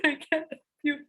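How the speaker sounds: laughing through the words. Retold or imitated. Mom to daughter on the toilet, laughing refusal. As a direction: giggle while refusing. Retelling her funny refusal.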